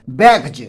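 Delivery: बैग्स ending in a voiced "j"; final j sound. Shown as a demonstration. Teacher shows how to read बैग्स, final s turned to j. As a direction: say बैग्स as "baig-j", not "baiks". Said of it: "not bags".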